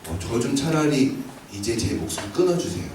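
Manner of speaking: soft, hopeless plea, a touch of despair; despairing prayer. Retold or imitated. Elijah under the broom tree pleading to God, soft and despairing. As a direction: beg quietly like someone ready to give up. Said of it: "하나님 앞에 죽기를 간 거예요".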